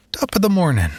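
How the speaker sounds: high-pitched, squeaky joke voice. A narrator's reading. Grandchild passing granddad a wiggling cup as a joke, squeaky voice. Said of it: "I said in a squeaky voice".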